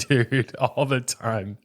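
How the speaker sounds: slow, stretched "all the time" amid laughter; drawn-out admission. Real, not acted. Dad confessing doubts to the host, drawn out with amusement. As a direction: drag out each word in amused confession.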